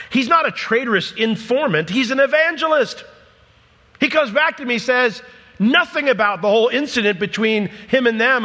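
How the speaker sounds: high-pitched, emphatic sermon delivery; forceful preaching. Real, not acted. Preacher defends the healed man as an evangelist, forceful preaching. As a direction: drive the point home with rising intensity.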